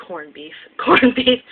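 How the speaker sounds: laughing through repeated words; giggling over a tongue slip. Real, not acted. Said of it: "I'm, like, out of it today".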